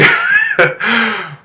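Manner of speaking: high-pitched burst of laughter; high, real laugh; giggle. Real, not acted. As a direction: giggle helplessly before carrying on. Cracks up again mid-story.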